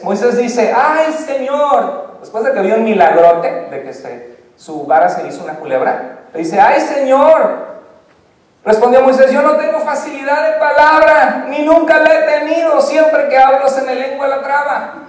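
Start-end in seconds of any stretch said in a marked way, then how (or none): 0.7-2.2 much higher, very slow, drawn-out lament; whiny sigh. Acted. Moses sighs to the Lord. 6.6-7.8 much higher, louder, very slow lament; whiny sigh. Acted. Moses sighs again, louder. 9.3-14.9 much higher and louder, desperate excuse; pleading Moses. Acted. Moses protests he can't speak.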